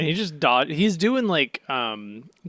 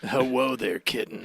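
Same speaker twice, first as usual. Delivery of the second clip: smooth flirt. Retold or imitated. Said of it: "and just goes".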